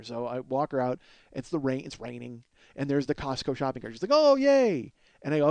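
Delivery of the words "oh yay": high, loud gleeful squeal; mock delight. Neighbour spotting the Costco cart in the rain, gleeful. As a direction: imitate someone overjoyed at a small find.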